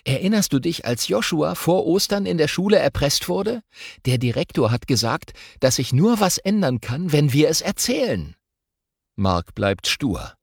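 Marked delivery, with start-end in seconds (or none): 0.1-8.4 a hint of a boy gently persuading, higher; lightly voiced boy. Boy urging a bullied friend to speak up, persuading. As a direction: remind him firmly but kindly.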